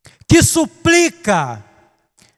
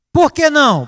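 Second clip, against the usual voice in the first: put-on child protesting; 6 semitones higher than usual. A child protesting his father's no, high whine. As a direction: whine the question like a kid.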